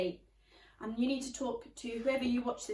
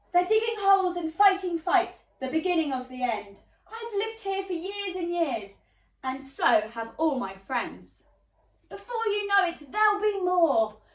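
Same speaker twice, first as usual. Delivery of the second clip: louder, outraged complaining; indignant protest; 7 dB louder than usual. Acted. Neighbours rant about the pirates, loud and outraged. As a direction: rant about pirates lodged next door.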